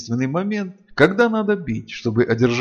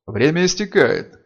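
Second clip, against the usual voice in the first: gloomy; 5 semitones higher, 5 dB louder than usual. Acted. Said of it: "сумрачно проговорил Чаргас".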